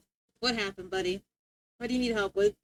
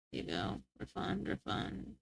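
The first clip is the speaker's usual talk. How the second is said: whispered to self; 7 semitones lower, 18 dB quieter than usual. Real, not acted. Muttering to self.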